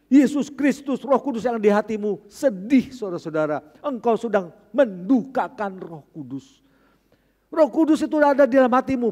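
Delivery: loud, high preaching with slowed emphasis; fiery preaching. Acted. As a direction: preach it hard to the congregation. Preaching climax. (berkhotbah dengan berapi-api, keras dan tinggi)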